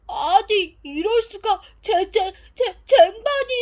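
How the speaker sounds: 높고 아주 큰 소리로 말을 더듬으며; 눈을 의심하듯 경악해서 외치기. Acted. 물에 뜬 쟁반을 보고 놀람. (깜짝 놀라 더듬는 목소리)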